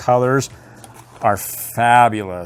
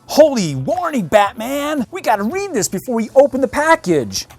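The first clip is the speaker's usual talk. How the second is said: high excited sidekick, much higher; Robin voice; 11 semitones higher, 3 dB louder than usual. Acted. Reading the toy warning to Batman as an excited Robin. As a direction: gasp it out like an eager boy wonder. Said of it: "read this with the voice of Robin".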